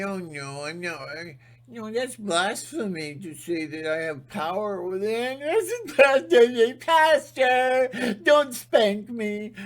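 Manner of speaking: high, whiny, frightened voice; mock timid believer. Mocking a believer afraid of the pastor, high and whiny. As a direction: whimper like a scared child.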